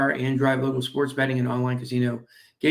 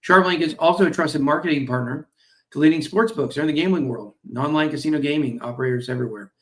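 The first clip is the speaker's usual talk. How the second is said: ordinary delivery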